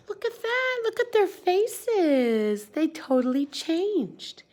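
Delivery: very high, slow amazed exclaim. Real, not acted. Teacher showing kids the happy faces, very high and amazed. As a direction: marvel at the change.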